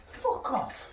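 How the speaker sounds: laughing curse. Real, not acted. Laughing after hitting head.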